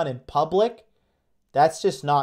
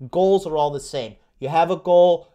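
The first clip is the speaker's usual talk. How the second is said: ordinary talk, nothing marked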